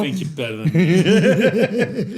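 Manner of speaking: slow, laughing put-on voice; mock tough guy. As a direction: stretch it out, cracking up. Repeats the accent bit.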